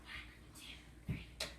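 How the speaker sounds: counting under breath; whispered count. Shown as a demonstration. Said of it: "Whisper count".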